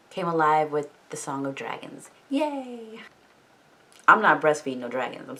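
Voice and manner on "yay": mock cheer. Mock cheer after dragon song.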